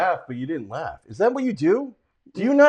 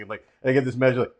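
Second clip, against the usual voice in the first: ordinary talk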